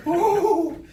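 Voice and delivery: high, loud, held "ooh"; hammed-up howl of self-control; straining ooh. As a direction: let out a long high whoop. Acts out fighting off arousal.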